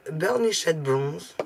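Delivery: put-on very slow, stretched English accent. Crafter naming a bronze mousse in an English accent. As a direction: savour each English word, very slowly. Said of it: "j'adore mon accent anglais".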